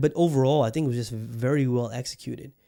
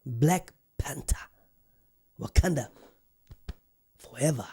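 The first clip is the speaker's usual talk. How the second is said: dramatic whisper. Acted. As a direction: whisper the title like a trailer. Whispered movie title intro.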